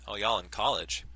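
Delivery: usual speaking voice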